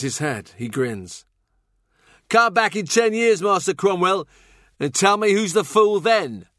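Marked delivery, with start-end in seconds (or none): 2.3-6.5 high, loud jester's challenge; mocking fool at full voice; taunting fool. A narrator's reading. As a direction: throw down a jeering challenge, pitched well up.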